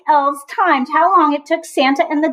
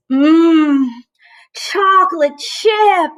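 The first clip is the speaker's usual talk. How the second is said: savoring; 5 semitones higher, 4 dB louder, much slower than usual. A narrator's reading. Santa savouring cookies.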